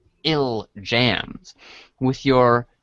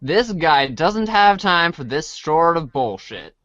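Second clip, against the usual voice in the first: usual speaking voice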